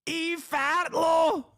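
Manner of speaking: high, slow, shouted mock Scottish; Scottish accent shout. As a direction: bellow it in a hammed-up Scottish brogue. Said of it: "I'm Scottish".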